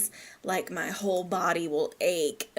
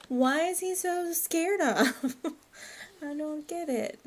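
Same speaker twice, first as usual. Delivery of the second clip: laughing; 7 semitones higher than usual. Real, not acted. Laughing over the toddler.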